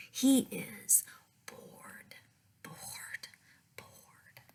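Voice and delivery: bored bored bored, each fainter in a whisper; whispered boredom. A narrator's reading. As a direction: let the boredom fade into a whisper. Reading bored, bored, bored.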